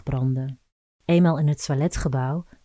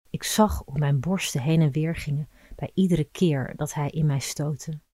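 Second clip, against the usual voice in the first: ordinary delivery, nothing marked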